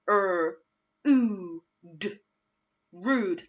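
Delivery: "rude" with long oo; oo-way blend. Shown as a demonstration. Teacher modelling the oo sound for the class. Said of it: "it's the oo one".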